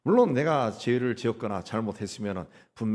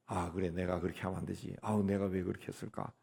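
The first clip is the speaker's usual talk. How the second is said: quiet regret; 4 semitones lower, 9 dB quieter than usual. Acted. Repentant believer regrets.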